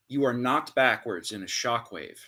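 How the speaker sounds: usual speaking voice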